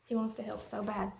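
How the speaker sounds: put-on storybook read-aloud for little kids; reading to children. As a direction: read it like story time for a class. Teacher reads picture book aloud to kids.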